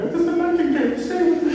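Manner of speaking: very high, quick falsetto lover's plea; hammed-up girlish squeal, begging a lover to stay; Juliet. Teacher plays Juliet pleading with Romeo, high hammed-up falsetto. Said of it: "Little Romeo and Juliet".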